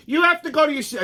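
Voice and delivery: imitating her high-pitched order; high, bossy mock command. Girl with a finger in his face, bossy order. Retelling a woman ordering him away.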